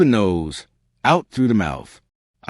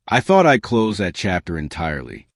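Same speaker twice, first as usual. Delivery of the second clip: plain reading voice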